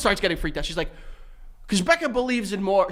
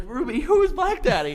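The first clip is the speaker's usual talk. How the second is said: higher, laughing interrogation; laughing question; 10 semitones higher than usual. Retold or imitated. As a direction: ask through a laugh. Dad asking, laughing.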